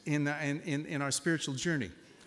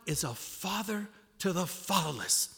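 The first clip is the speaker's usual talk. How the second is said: reverent reading; 4 semitones higher, slower than usual. Retold or imitated. Reading verse aloud to wife.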